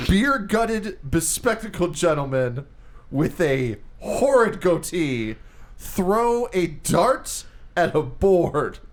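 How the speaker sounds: slow, deliberate, drawn-out offer; slow mock pitch. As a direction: lay every word out slowly like a sales pitch. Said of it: "Let me say this again".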